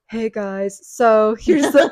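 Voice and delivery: lightly nasal, slowed imitation; mock blocked-nose voice, slow; nostrily voice. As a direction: imitate a vlogger with a stuffed nose. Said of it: "the nostrily kind of voice".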